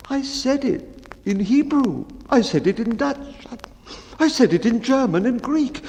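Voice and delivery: high, meek storyteller voice; the mildest of men pleading to be heard; timid baker. Acted. Baker recounting his sad story to the bellman, high and meek.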